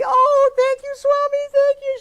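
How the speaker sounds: overjoyed gushing. Retold or imitated. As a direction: imitate a woman gushing with happy gratitude. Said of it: "she was so happy".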